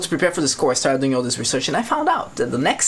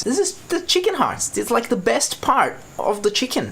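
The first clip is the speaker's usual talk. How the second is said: defensive explaining; 9 semitones higher than usual. Retold or imitated. Cook defends dish to dismayed diners.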